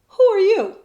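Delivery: high, loud startled question; startled girl crying out. Acted. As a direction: jump at the sight of them.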